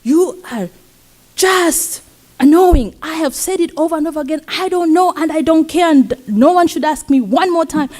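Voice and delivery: high-pitched exasperated mock yell; retold angry yell. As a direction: snap like a fed-up attendant, pitched well up. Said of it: "she yells".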